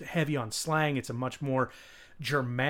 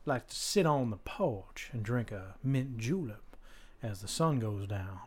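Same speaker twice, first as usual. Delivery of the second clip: slow, softer Southern plantation drawl; Kevin Spacey; 3 semitones lower, 5 dB quieter than usual. Acted. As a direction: stretch it out like a Georgia plantation owner. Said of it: "essentially Kevin Spacey".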